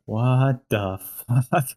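very slow, drawn-out reaction; acted slow-motion astonishment; stunned disbelief. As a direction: stretch each word out in disbelief. Acts out Tom's stunned reaction.

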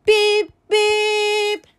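high, held double beep; two bright toots; horn beeps. A narrator's reading. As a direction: imitate a little truck horn. Little truck sounding its horn.

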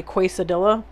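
"quesadilla" as "kway-sa-dilla", hard double L; deliberate mispronunciation. Retold or imitated. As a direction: say "quesadilla" wrongly on purpose, as "kway-suh-DILL-uh". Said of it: "I wanted to say quesadilla".

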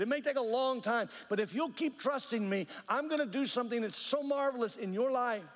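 rising, urgent preacher's exhortation; fervent preaching. Real, not acted. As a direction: preach it with building fervour, pitch climbing.

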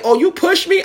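very high-pitched retold accusation; high, affronted question; mock outrage. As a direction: imitate an outraged mother, voice way up. Acted quarrel, drunk mother accusing.

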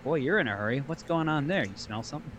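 calm low teasing. Retold or imitated. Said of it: "he's like".

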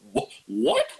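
high, stunned retold what; shocked. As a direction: caught completely off guard. Retold shock at hunt date.

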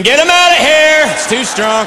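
loud and high in a panic; screaming scientist. Retold or imitated. Scientist in the lab screaming about the shampoo, loud and high. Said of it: "he's screaming".

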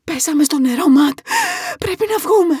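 high, loud alarm; mother's high-pitched panicked cry; panicked urging. A narrator's reading. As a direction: urge him out, frantic. Panicked mother in sinking car.

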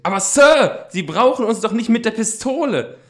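high, loud nervous protest; frightened plea. Acted. Boy at gunpoint protests to the man, frightened plea. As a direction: plead against the gun in a rush of fear.

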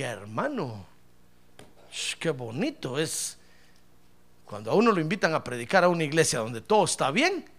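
ordinary talk

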